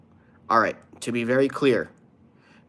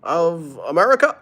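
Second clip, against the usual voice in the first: higher, louder, very slow presidential cadence with long pauses; hammed-up Obama speech, higher and louder; Obama impression; 7 semitones higher, 5 dB louder, slower than usual. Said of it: "Let's do a B-, Obama impression".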